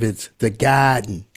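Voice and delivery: garden said as gahden with no r; Boston gahden; native Boston pronunciation. Shown as a demonstration. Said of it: "if you're a native".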